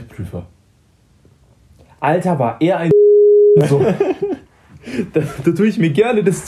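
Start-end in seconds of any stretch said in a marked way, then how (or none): 3.0-3.6 loud sudden laugh; burst of laughter. Real, not acted. Telling a friend about the examiner, bursting out laughing. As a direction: break out laughing at the memory.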